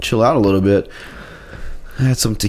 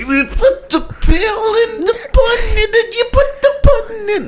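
Bill Cosby. Acted. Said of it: "That's my Bill Cosby".

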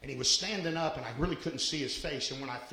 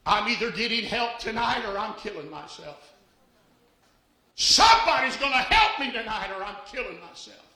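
rising from firm to a loud cry, higher; retold desperate scream; 7 semitones higher, 9 dB louder than usual. Preacher retelling the woman at the altar, rising to a loud cry. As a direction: build into a desperate louder cry. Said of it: "fell on the altar screaming".

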